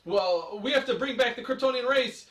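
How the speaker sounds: high, loud hammed-up seriousness; mock dramatic. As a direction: parody deadly serious superhero dialogue. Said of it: "so dramatic about it".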